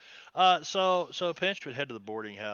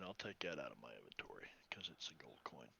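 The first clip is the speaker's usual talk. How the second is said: whispering; 10 semitones lower, 14 dB quieter than usual. Acted. Whispered aside while flipping coin.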